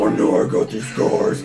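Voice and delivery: raspy whispered growl; Batman voice. Retold or imitated. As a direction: rasp it like a vigilante. Continues Batman rasp.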